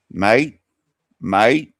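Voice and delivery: loud, rising Australian "mate"; amateur Australian impression; attempted Aussie accent. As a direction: try out an Aussie accent, badly. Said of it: "I can't do an Aussie accent".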